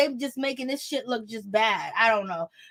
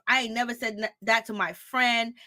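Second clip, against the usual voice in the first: ordinary talk, nothing marked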